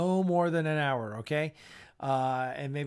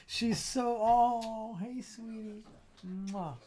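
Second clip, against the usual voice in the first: cooing at puppy; 6 semitones higher, much slower than usual. Real, not acted.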